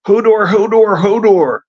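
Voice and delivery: high, loud repeated bellow; roaring. A narrator's reading. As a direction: roar the name in a panicked run. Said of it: "he roared".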